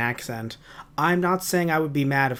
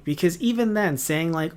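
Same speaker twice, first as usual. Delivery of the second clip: ordinary delivery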